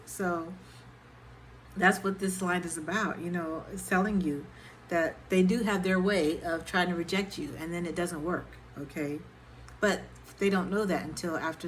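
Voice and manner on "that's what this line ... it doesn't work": ordinary talk, nothing marked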